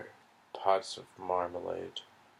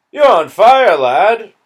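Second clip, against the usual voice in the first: loud, high English soldier's cry; sudden high alarmed call; alarmed shout; 11 semitones higher, 20 dB louder than usual. Acted. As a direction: shout the warning at the boy. Englishman alarmed at burning boy.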